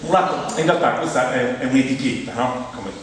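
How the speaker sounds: ordinary talk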